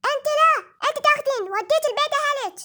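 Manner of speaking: very high, fast, eerie threat; scary voice. Acted. Threatening parent doll in a very scary voice. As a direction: screech it like a frightening doll. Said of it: "صوت مرعب جدًا".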